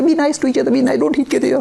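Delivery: high, pleading mock voice; sing-song high preachy plea. Mocking people who say be nice to each other, in a high pleading voice.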